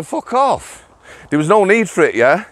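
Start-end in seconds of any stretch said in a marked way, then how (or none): 0.0-0.9 high, bellowed Yorkshire "fuck off"; a Yorkshire telling-off; Yorkshire accent. Retold or imitated. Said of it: "in my finest Yorkshire accent".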